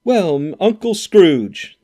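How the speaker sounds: loud, high, slow cheer of Uncle Scrooge; the whole party shouts the toast; group toast cry. A narrator's reading. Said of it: "they cried".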